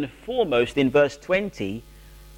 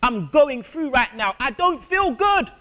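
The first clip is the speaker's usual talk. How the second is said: pleading cry to God, pitched up; a hurting believer crying out to the Lord; anguished prayer; 8 semitones higher than usual. Pretend. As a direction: raise the voice in pained prayer. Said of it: "It's okay to say".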